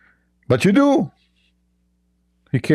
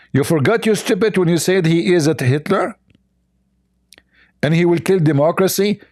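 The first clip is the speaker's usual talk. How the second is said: ordinary delivery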